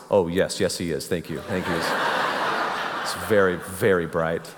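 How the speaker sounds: put-on teen faking his dad's deep voice on the phone. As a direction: drop the voice to pass as your father. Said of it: "I lowered my voice".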